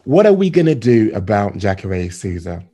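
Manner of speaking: ordinary talk, nothing marked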